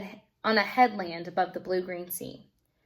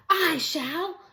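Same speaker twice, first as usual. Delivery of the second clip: high bold volunteering; eager hero's cry, higher; brave cry; 8 semitones higher than usual. Acted. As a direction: step forward boldly. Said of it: "cried Beckon".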